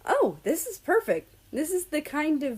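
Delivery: catching his breath at the view, then gushing; gasping delight. A narrator's reading. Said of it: "He gasped".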